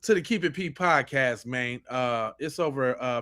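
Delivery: usual speaking voice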